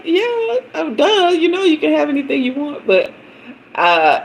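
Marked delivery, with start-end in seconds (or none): 0.0-2.9 put-on playful boyfriend voice.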